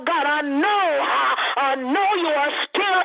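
fervent prayer. Real, not acted.

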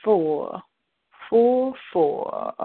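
drawn-out phone-line announcer parody; radio host. Pretend. Radio host reads a dial-in number, playful put-on voice.

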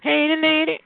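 high teasing call. Acted. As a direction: call her name out high. Calling out to caller.

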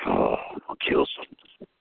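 low, slow, unvoiced menace; mock menacing threat. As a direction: fake a crazy threat under your breath. Faking crazy to get admitted.

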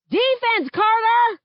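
high, loud yell across the court; coach yelling. A narrator's reading. Coach calling to a player on court, loud yell. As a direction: bellow it over the gym. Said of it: "Coach yells".

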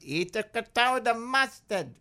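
high mock German accent. Wife ordering him to eat creton, mock German accent. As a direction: bark it in a comic German accent. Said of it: "I'm making her German now".

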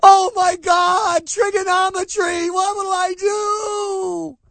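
high, shrieking, drawn-out dimwit panic; hammed-up helpless screech; mock panicked cashier. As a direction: squeal it like a cashier overwhelmed by simple math. Mocking a clueless cashier's panic.

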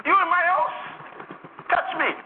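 high, incredulous mock Haitian accent; Haitian old lady. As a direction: imitate an amazed old Haitian woman. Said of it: "she could hardly speak English".

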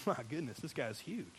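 quiet, awed under the breath; a hushed gasp of wonder. Retold or imitated. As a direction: drop to an awestruck hush at the giant. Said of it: "David thinks one thing, like".

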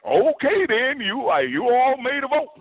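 a hint of a dopey cartoon dog; Goofy voice.

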